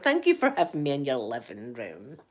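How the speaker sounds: low, lingering swoop through the thanks. Real, not acted. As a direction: stretch it out, sinking low then lifting. Repeats thanks to viewers, differently.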